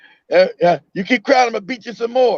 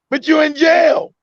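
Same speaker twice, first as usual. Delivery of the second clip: emphatic retort; 6 semitones higher, 3 dB louder, slower than usual. Real, not acted. Mocking those who praise whipping.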